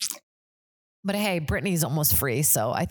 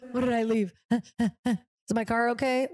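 mock panic, higher and slower; panicked morning-after self; 6 semitones higher, slower than usual. To a friend, reliving hungover panic, higher, slow and nervous. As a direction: replay the hungover dread with a nervous laugh. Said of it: "the next day feeling".

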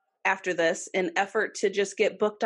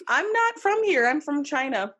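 lightly voiced killer; 7 semitones higher than usual. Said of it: "he claimed that".

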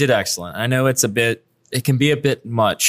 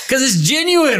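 laughing; 14 semitones higher than usual. Real, not acted. Host laughing at guest's answer.

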